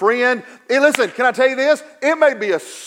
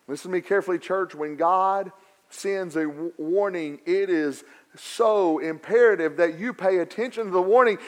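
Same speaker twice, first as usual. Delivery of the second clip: ordinary talk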